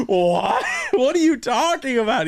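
high, incredulous what; retold disbelief. Telling his cohost how he reacted to the father-in-law, high and incredulous.

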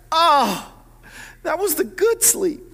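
high-pitched groaning complaint; hammed-up woken-dad moan; mock lament. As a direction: whine over precious lost sleep. Woken dad laments lost sleep.

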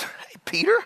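breathy whisper as Jesus; whispered aside. Acted. Jesus quietly praising Peter, in a whisper. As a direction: lean in and whisper his name. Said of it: "And Jesus says".

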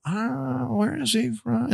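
high, soft puzzled wondering; puzzled tourists. Retold or imitated. Tour guide mimics puzzled tourists, high and soft. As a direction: mimic tourists squinting at his accent. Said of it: "people would go".

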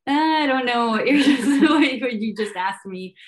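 slow, amused mock confusion; baffled retelling. Newcomer baffled by a New England accent, laughing bewilderment.

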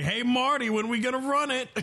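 high, teasing call-out; taunting Askren. Retold or imitated. Askren taunting Usman at events, high teasing call. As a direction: needle him with a high sing-song hey. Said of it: "just going like".

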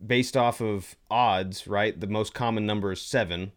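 normal talking voice

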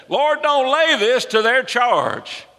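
high, drawn-out cry to the Lord; shouted prayer. Acted. Dying Stephen praying for those stoning him, shouting. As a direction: call it out slowly and high. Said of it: "And old Stephen shouted".

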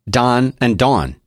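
slow, "Don" and "Dawn" said one after the other for comparison; careful pronunciation. Shown as a demonstration. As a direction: lay the two names side by side slowly. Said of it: "say, say those two again".

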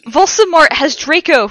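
high, loud cry of alarm; shouting. A narrator's reading. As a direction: shout the urgent news. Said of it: "we shouted at the same time".

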